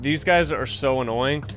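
usual speaking voice

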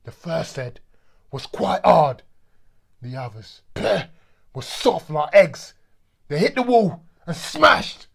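shouting. Acted. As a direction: roar out the violence. Violent climax of the story.

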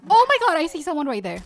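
loud, fast startled blurt; real alarm. Real, not acted. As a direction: blurt it out as you spot danger. Spots an enemy nearby.

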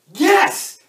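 loud, high shout of triumph; high, punchy cheer to the team; triumphant yes. Acted. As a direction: shout it like a winning answer. Cheering own sales idea.

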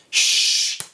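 unvoiced shush for the beat; quick "shh" hiss; sh sound. Shown as a demonstration. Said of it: "make a sh sound".

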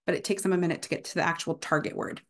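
usual speaking voice

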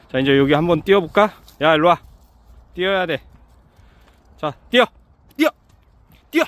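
loud, high calls to run; yelling from the sidelines. Real, not acted. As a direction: shout at the dogs to run. Urging dogs to run.

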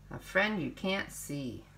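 usual speaking voice

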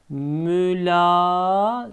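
slow drawn-out "Mülazemet"; careful slow "Mülazemet"; slow model reading. Shown as a demonstration. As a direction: stretch it for the class. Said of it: "Kelimemiz neymiş".

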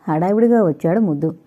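plain reading voice

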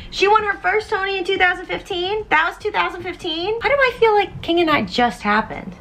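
high, incredulous questions; a high, stunned rant; disbelieving. Real, not acted. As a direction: keep asking, pitched high in disbelief. Disbelief at the year.

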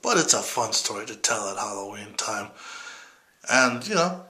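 ordinary delivery

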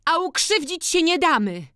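very high, strained woman's yell; shriek. A narrator's reading. Wronged daughter-in-law jumping up, shrieking. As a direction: shriek it out in protest. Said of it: "wrzasnęła Hanka".

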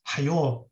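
"hayo" ending on a long o; Hijazi o vowel. Shown as a demonstration. Quoting the grammarian's rule, "hayo" with long o. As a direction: say "hayo" with o replacing the final a. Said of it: "these words should be pronounced as".

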